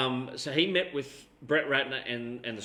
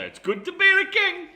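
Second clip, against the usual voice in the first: much higher and louder, a mock royal boast; grand catchphrase; 8 dB louder than usual. Co-host capping the story with the catchphrase, high and loud. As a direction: crow it like a smug king.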